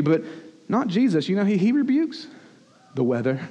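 usual speaking voice

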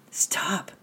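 high, husky cry of warning; husky call. A narrator's reading. Philip calling to the climber on bending branches, husky call. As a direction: call out hoarsely, voice leaping up. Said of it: "called Philip in a rather husky voice".

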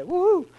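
high-pitched "woo-hoo" cheer; high whoop. Real, not acted. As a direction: whoop it high for the congregation to echo. Leads congregation in a cheer.

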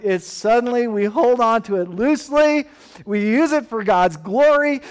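loud, high, driving sermon voice; full-force preaching. Acted. Pastor preaching loudly about wealth as God's gift. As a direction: preach it with conviction to the church.

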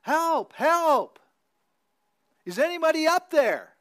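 high, loud desperate cries for help; yelling for help. A narrator's reading. Jack dangling from a cliff, yelling for rescue. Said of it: "Jack began yelling for help".

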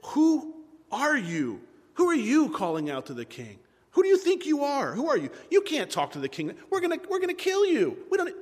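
affronted, pitch leaping high on the threats; indignant challenge. Acted. Abner challenging the stranger calling to the king, indignant.

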